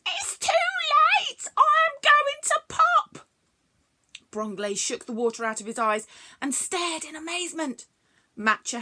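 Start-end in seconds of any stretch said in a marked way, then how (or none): 0.1-3.3 much higher, louder squeak; squeaky panicked creature. Acted. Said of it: "in a high-pitched, squeaky voice".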